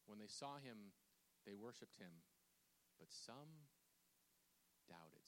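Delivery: soft, low reading with weighty pauses; hushed scripture reading. Acted. Preacher rereads the verse to congregation, hushed and low. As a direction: read it low and let the last words sink.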